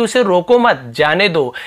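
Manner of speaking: short comma break between मत and जाने; pause after मत. Shown as a demonstration. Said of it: "मत के बाद लगा हुआ है". (मत के बाद अल्पविराम, फिर "जाने दो")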